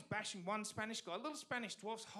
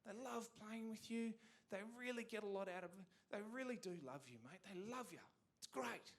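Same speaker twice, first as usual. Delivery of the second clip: softer, lightly voiced gushing staff; gushing coworkers; 8 dB quieter than usual. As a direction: coo praise at Greg, a bit sugary. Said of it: "they just kept saying".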